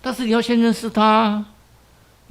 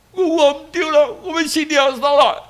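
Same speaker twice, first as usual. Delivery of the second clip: repentant plea; 6 semitones higher than usual. Acted. Mother kneels repenting.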